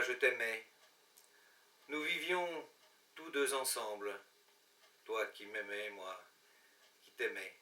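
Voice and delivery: hushed, wistful poetry reading with long pauses; measured, melancholy recital; poem recitation. Acted. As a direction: recite a lost-love poem line by line, letting endings fade. Recites Les Feuilles mortes as a poem.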